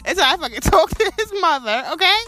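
high, loud retold telling-off; angry retort. Mom retelling her refusal, loud and angry. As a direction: snap back hard, like the moment itself.

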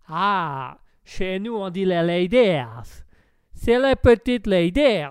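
hammed-up American with a wobbly accent. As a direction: drawl it as an American. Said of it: "Et vous, l'Américain?". (accent américain approximatif)

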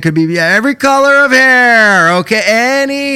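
excited. Acted. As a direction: build it up like a movie reveal. Building excitement describing reveal.

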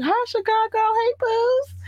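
high cutesy hello to fans; squeaky greeting. Real, not acted. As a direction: chirp a hello in a tiny voice. Playful greeting to listeners.

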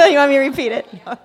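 teasing the crowd through a laugh. Real, not acted.

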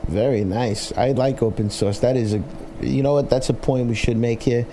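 ordinary talk, nothing marked

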